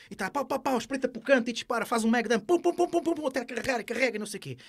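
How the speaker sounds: high, fast game shooting sounds; excited gamer imitation. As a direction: rattle off the shots like a kid gaming. Mimics video game shooting.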